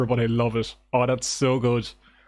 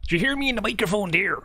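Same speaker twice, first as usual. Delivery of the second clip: hyped stream greeting; 9 semitones higher than usual. Real, not acted. Streamer greeting chat at start.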